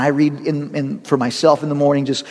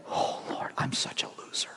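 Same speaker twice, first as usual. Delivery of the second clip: whispered, despairing mock prayer; mock self-pity whisper; 16 dB quieter than usual. Pastor mocking a self-condemning prayer, hushed whisper. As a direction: whisper it like a defeated sinner.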